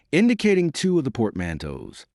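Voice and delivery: plain reading voice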